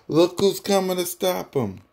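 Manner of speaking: normal talking voice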